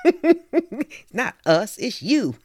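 laughing through a playful retort; high, giggly "it's not us, it's you". Real, not acted. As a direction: tease them while laughing. Laughing retort about accent.

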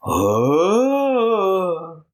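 long echoing ghostly wail; tormented echoing moans. Pretend. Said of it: "That's my ghost impression".

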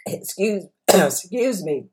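words squeezed through a choked throat; real strangled catch in the voice. Real, not acted. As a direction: voice catching and cracking mid-word, throat clearing. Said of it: "I got strangled. My voice changed a little bit".

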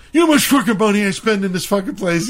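fast, high retold rant; outraged mock scream. Friend berating the manager about money spent, mock screaming. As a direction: rattle off a theatrical customer complaint. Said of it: "'cause he's screaming".

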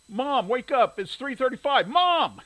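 high, loud panicked yell; panicked shouting. Acted. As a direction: wake her up in a panic, full voice. Boy wakes his sleeping mother.

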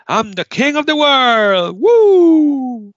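high, loud, drawn-out whoop; triumphant cry. Acted. Game host acting a director winning for Titanic, exultant. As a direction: let the victory burst out and soar.

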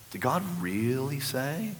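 soft, slow snake's question with REALLY stretched; quiet, insinuating serpent voice; serpent's hiss. Acted. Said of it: "It's got that hiss".

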